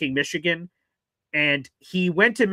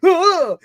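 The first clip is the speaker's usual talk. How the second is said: high, louder mock gasp of shock; startled yelp; 13 semitones higher, 8 dB louder than usual. Seeing fake trade news, sharp high yelp. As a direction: let out a sudden startled cry. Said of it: "And I'm like".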